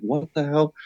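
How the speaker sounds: high, hushed voice of a spooked deer; startled buck. Retold or imitated. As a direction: voice the buck as it freaks out. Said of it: "He's like".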